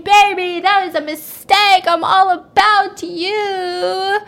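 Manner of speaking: high, slow, loud coaxing plea; sweet-talking husband. Retold or imitated. Husband sweet-talks his angry wife, slow and pleading. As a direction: beg her forgiveness, drawn out. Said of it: "he's just saying".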